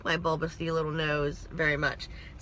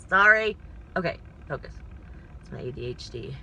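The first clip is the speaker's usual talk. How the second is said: usual speaking voice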